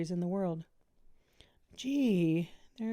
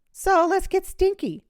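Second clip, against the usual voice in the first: much higher and louder, gleeful; playful high exclamation; 12 semitones higher, 9 dB louder than usual. A narrator's reading. Miss V answering Melody's joke, gleeful and high. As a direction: toss back the joke with glee.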